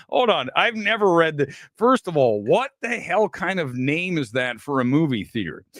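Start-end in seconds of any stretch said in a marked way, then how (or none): none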